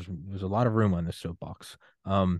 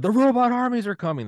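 high, loud alarmed proclamation; doomsday street preacher shout; 6 dB louder than usual. Pretend. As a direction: yell it like a sidewalk doomsayer. Doomsday crank with cardboard sign.

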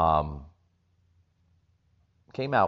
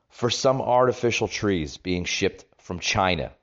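ordinary delivery

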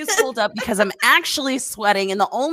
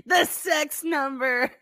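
slow teasing; 4 semitones higher, much slower than usual. Real, not acted.

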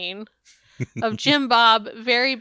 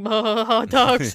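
drooling over food; much slower than usual. Retold or imitated. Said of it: "I'm like".

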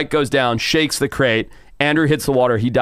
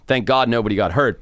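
ordinary delivery

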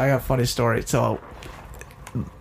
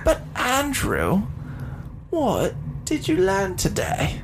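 slow, high fake English question; mock English accent; 6 semitones higher, slower than usual. Handing over to his co-host, mock English accent. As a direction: ask grandly in hammed-up English.

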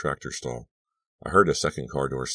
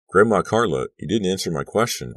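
louder, calling down the hall; calling after her; 5 semitones higher, 5 dB louder than usual. Acted. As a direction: call out after someone leaving. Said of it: "I called after her".

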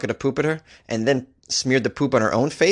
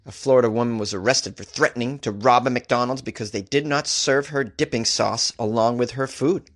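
usual speaking voice

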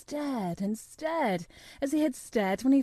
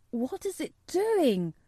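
excited cry; 6 semitones higher, slower than usual. A narrator's reading. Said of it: "cried Colin".

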